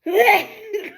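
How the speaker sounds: harsh coughing burst; real cough, loud; coughing fit. Real, not acted. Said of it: "Having a little coughing spell".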